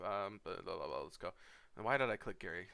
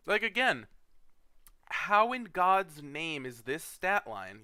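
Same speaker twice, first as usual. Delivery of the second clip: high, loud and slow disbelief; exasperated; 5 semitones higher, 7 dB louder, slower than usual. Real, not acted. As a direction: drag out each word in disbelief. Ranting at a bad pick.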